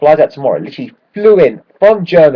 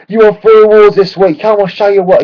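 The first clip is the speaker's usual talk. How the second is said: mocking fan; 9 semitones higher, 5 dB louder than usual. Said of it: "some guys giving Gareth Bale shit".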